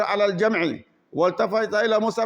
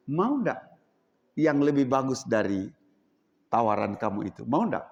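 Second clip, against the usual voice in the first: gentle offer; 8 semitones lower than usual. Acted. Mus'ab offering something better to the chief, gentle. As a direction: coax him kindly.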